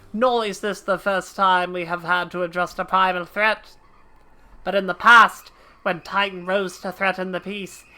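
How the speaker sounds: brisk officer's report; understated military briefing; naval officer reading orders. Acted. Voices NPC dialogue.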